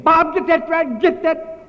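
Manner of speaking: very high, loud frantic shriek; imitated scream. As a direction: mimic her screaming orders at her husband. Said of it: "holding her feet like this and screaming".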